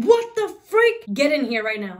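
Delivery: mock angry mom shout; high, loud shriek of an outraged mom. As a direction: yell it like a mom who just caught you. Mom shouting on seeing haircut.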